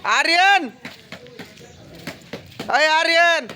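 high calling shout across the yard. Real, not acted. Calling son from afar.

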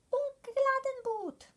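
high, loud, slow bug cry; excited bug cry. Acted. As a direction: cry out in wonder like a tiny bug. Bug exclaiming.

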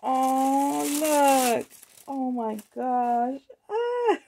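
high, drawn-out gushing over something cute; overcome with delight. Real, not acted. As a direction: coo and squeal over a darling gift. Overcome by cute gift baskets.

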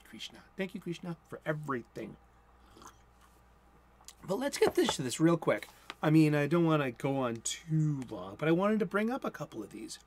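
usual speaking voice

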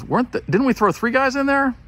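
high, startled double take; surprised king. Acted. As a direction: sound baffled as if counting again. King sees four men in fire.

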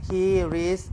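"He reads" said slowly, each word pulled apart; slow separated He reads. Shown as a demonstration. Teacher separating words for Thai students, slowly. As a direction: split "He" and "reads" with a clear gap. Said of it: "ถ้าจะแยก".

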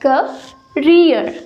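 करियर slowly as KA-ri-er, short a; correct pronunciation. Shown as a demonstration. Teacher slowly modelling the right word. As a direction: drag out करियर, short first vowel. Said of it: "not career, it's career".

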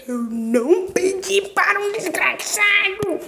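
high, strained angry yell; enraged outburst. Real, not acted. Player cursing a wretched enemy, high raging yell. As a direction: explode in outrage at the game.

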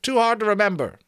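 high, quick piping excuse; sentry's voice. Acted. Sentry excusing the changed password, high quick voice. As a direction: toss out the excuse high and fast. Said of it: "came the voice of the sentry".

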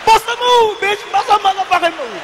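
high-pitched, hammed-up confrontation; high comic outrage; mock street challenge. Acted confrontation with small child.

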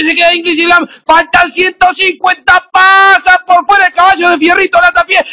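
building to an excited shout; horse race commentator. Real, not acted. Horse race call final stretch. (narrador hípico emocionado, cada vez más alto)

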